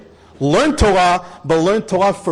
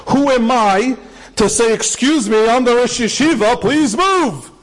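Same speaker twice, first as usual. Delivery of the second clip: fervent emphasis; 6 semitones higher, slower than usual. Retold or imitated. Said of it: "Listen to those words".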